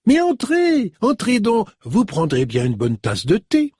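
high, effusive welcome; delighted older woman. A narrator's reading. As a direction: greet an old friend with a burst of joy. Mrs Buckle inviting in.

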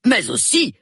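sudden loud, high burst of indignation; indignant outburst. A narrator's reading. Colonel rebounds from contrition, bursting out indignantly. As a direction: explode abruptly, high and loud. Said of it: "explosa-t-il soudain dans un regain d'indignation".

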